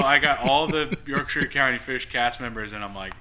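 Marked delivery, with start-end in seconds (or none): none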